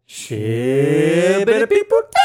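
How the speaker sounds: slow, stretched-out nonsense chant; catchphrase. Pretend. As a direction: drag out the silly catchphrase loudly. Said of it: "As we always say".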